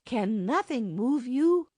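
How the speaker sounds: high, slow, loud plea; imploring. A narrator's reading. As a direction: drag out the plea, pitched high. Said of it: "she implored".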